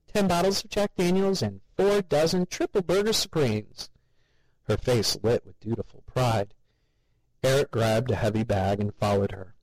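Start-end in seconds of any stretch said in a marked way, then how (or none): 0.1-3.9 high, eager housewife voice; chirpy mother proud of her shopping; cheery mother. A narrator's reading.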